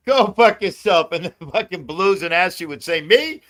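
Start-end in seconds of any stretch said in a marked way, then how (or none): 0.1-1.1 loud, higher, drawn-out blurt; amused retold outburst. Telling Denny what he blurts at home, loud and amused. 3.0-3.4 high, loud, incredulous squeak of a question; mock startled query. Housemates think he means them, mimicked in startled high pitch.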